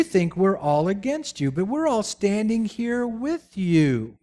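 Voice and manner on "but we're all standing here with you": ordinary talk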